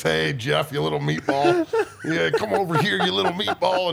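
mock taunt. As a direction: taunt him like a bully, half laughing. Taunting Jeff in imagined fight.

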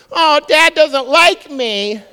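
put-on high, loud, slow whimper of a kid; a high, slow, mock-sulky child. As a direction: pout like a hurt child. Child in front group voiced.

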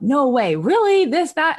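imitating kids' stunned disbelief; amazed kids. Kids' amazed reaction retold.

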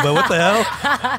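high, loud, laughing what the hell; slow amused outrage; laughing disbelief. Real, not acted. Laughing at friend's joke.

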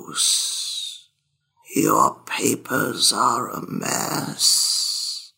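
slow whispered nagging ghost, dropping low at the end; whispering ghost. Acted. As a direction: hiss it like a haunting scold. Ghost calls to Iavos.

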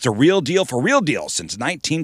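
upbeat radio ad announcer; ad read. Acted. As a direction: sell it like a radio spot.

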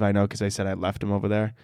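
usual speaking voice

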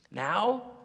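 ordinary delivery

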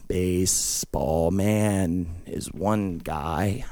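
very low, quiet, dragging voice; soft, ponderous slow-talking drawl; slow talker. Acted. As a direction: explain it slowly, with long gaps between words.

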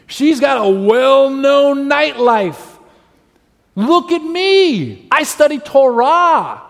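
high, loud, drawn-out boasting; hammed-up pious man looking down on a sinner; self-righteous Pharisee. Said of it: "He feels like".

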